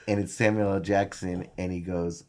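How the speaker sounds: ordinary talk, nothing marked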